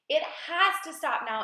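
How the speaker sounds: high, loud exclamation; yell. A narrator's reading. Aunt protests the endlessly growing peach, loud yell. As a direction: yell it out insistently. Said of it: "Aunt Spiker yelled".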